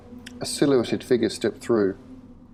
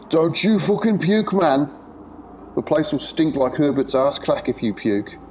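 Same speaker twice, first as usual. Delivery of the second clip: put-on thick Scouse accent; 6 semitones higher than usual. A narrator's reading. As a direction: bark the warning in broad Scouse. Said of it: "came the same Scouse accent".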